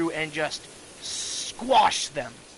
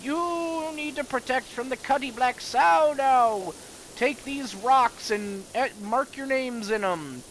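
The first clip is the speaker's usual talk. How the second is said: high-pitched hammed-up granny warning; dying old grandma; 7 semitones higher than usual. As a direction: urge them in a high old-woman voice. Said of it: "she's like".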